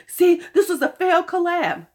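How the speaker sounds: high, loud smug mockery; mocking know-it-all fans. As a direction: parrot the gossip. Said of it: "everybody's like".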